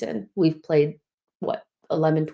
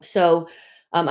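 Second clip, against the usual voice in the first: ordinary delivery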